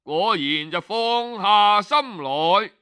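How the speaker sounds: ordinary delivery, nothing marked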